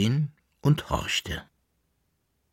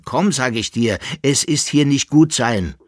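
louder, higher, pressing plea; urgent insistence; 6 semitones higher, 6 dB louder than usual. A narrator's reading. Jäckele-Narr presses his friend to leave, urgent. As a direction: urge him firmly to come away. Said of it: "redete der Jäckelnarr auf ihn ein".

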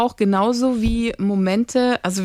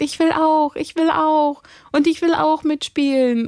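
put-on high, slow pleading little-girl voice; imitating a small girl; 7 semitones higher than usual. Mother voicing her little daughter begging to join, high and pleading. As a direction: whine it like a small girl left out. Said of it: "die sagt".

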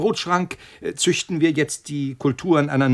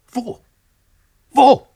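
high and much louder, panicked; frantic shout; 7 semitones higher, 7 dB louder than usual. Acted. Scientist demands where the poison is, frantic shout. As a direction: scream the question.

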